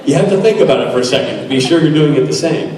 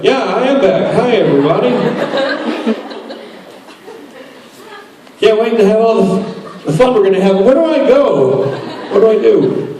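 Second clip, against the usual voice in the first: higher, forced chipper greeting with nervous laughs; stiff nervous cheer; 7 semitones higher than usual. Retold or imitated. Returning actor greeting the crew, higher, stiff forced cheer. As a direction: overdo the cheerful hello, all nerves. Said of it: "I'm so stiff and nervous".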